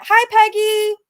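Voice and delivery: ordinary talk, nothing marked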